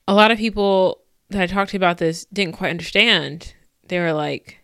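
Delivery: ordinary delivery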